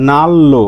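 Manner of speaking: "নাল্লো" with a doubled L; correct assimilated pronunciation. Shown as a demonstration. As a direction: turn N and R into L, "নাল্লো". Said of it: "নান রো না হয়ে হবে". ("নাল্লো" জোড়া ল দিয়ে উচ্চারণ)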